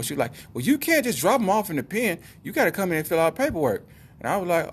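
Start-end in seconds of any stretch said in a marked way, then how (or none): 0.5-3.9 high, mock-scolding lady voice. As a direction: imitate a shelter lady telling someone off, pitched way up. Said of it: "she yelling at me like".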